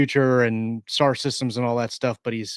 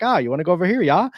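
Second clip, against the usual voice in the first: put-on higher-pitched German-accented mercenary, rising at the end; 6 semitones higher, much faster than usual. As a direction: play a hulking mercenary with a sing-song German accent. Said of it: "he speaks with a German accent".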